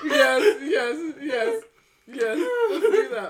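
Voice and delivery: eager, laughing yeses; laughing agreement. Real, not acted. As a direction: agree while cracking up.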